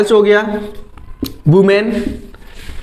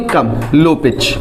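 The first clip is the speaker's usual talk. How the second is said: much lower and slow; low pitch demo; 8 semitones lower, much slower than usual. Shown as a demonstration. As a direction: drop the voice low to show it. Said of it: "pitch भी आपकी क्या होगी". (नीची धीमी आवाज़ में)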